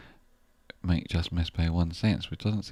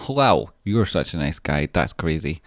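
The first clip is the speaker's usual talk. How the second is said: normal talking voice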